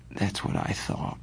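sleepy, almost whispered; drowsy. A narrator's reading. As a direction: drift off as you say it. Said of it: "I said drowsily".

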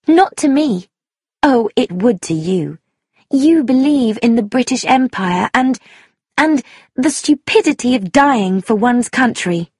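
high, impassioned, heavily stressed speech; vehement young woman. Acted. Young woman railing against patriotism, vehement. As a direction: argue hotly, hammering each phrase. Sheila argues passionately.

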